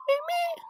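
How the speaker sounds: very high-pitched imitated meows; cat meow. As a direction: mimic a cat mewing up high. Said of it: "My, my cat Ellie goes".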